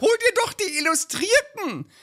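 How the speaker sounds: hohe, drängende Ehefrauenstimme; nörgelnde Ehefrau. Acted. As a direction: den Mann antreiben. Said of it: "so ein Lieblingstext". (Ehefrau drängt ihren Mann, hohe Stimme)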